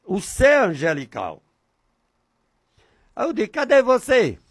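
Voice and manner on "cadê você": short, higher-pitched call; calling to God. Shown as a demonstration. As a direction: call out briskly without stretching the vowels. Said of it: "Desse jeito". (chamado curto e mais agudo)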